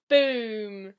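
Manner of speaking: loud spoken "boom" gunshot noise; imitated gunshot; mock gunshot. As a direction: shout the bang. Gunshot sound effect.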